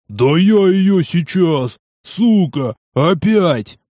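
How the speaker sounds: raging, strained, out of control; furious roar. Acted. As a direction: roar it in blind fury. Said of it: "Жирный взревел не своим голосом". (яростный рёв не своим голосом)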